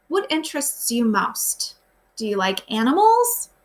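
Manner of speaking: usual speaking voice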